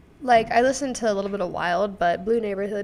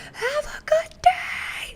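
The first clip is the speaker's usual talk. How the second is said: high, drawn-out strained call; imitated distant yell; much slower than usual. Imitating the janitor calling from the sixth floor, mock yelling. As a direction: holler it slowly as if from an upstairs window. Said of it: "he yells out a window".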